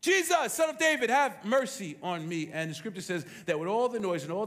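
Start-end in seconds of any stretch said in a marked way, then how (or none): 0.0-2.5 loud high cry, then slowing on mercy; crying out louder. Acted. Blind Bartimaeus crying out to Jesus over the crowd, loud and high. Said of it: "he cried out louder".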